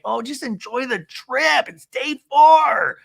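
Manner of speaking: high, drawn-out mock shout; hammed-up exasperated yell; exasperated outburst. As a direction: wail it slowly, building to a shout.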